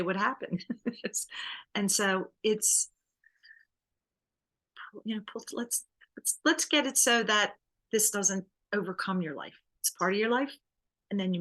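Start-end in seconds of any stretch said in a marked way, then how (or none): 5.0-6.4 whispered aside. Real, not acted. Aside while thinking.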